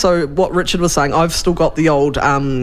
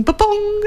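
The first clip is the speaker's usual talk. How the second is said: startup jingle. Retold or imitated.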